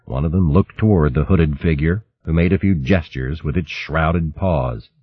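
ordinary delivery

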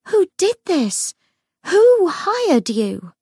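high, slow shrieked questions; shrieking demand. Acted. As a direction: shriek it at the ghost. Lucy demands the name.

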